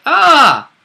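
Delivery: ordinary talk, nothing marked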